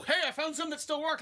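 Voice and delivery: mock carefree friend; a hint of a proud goofball; oblivious cheerful guy. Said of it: "he's out there alone going".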